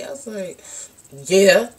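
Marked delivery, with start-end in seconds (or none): 1.3-1.7 a loud, defiant yeah. Retold or imitated. Answering mom's question with louder defiance. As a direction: toss it back with attitude. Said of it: "I had, like, an attitude".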